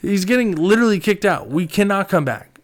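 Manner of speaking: high, loud exasperated yell. Retold or imitated. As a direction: shout at a stubborn friend. Friend refuses to leave bar.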